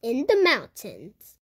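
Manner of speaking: plain reading voice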